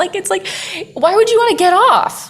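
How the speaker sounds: high, loud, giddy delight; gushing excitement. Real, not acted. Telling the host how fun a slip and slide is, giddy.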